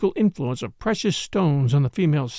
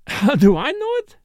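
higher, louder, scornful retort; scornful, excited; 9 semitones higher, 5 dB louder than usual. A narrator's reading. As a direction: toss the question back, scoffing. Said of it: "with a laugh".

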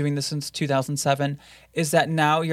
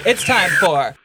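louder show intro voice; announcer voice; 3 semitones higher, 6 dB louder than usual. Pretend. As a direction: announce the segment like a host. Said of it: "announcing ghost segment".

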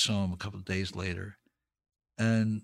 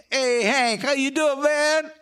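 high, loud greeting shout; calling out; 6 dB louder than usual. Retold or imitated. Harlem crowd greets the comedian with a high loud call. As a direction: call out to Hank across the theater, high and loud.

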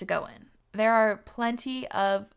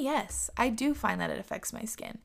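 usual speaking voice

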